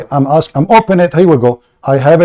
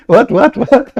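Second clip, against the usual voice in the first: laughing disbelief; 8 semitones higher, slower than usual. Real, not acted. Laughing at the reading.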